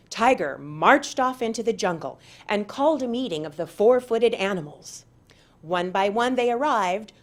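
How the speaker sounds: ordinary delivery, nothing marked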